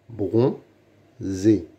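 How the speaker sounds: "Bronzé" as bron, pause, zé; syllable by syllable. Shown as a demonstration. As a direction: sound out "Bronzé" in two separate beats. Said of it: "Lis les mots". (« Bronzé » découpé syllabe par syllabe)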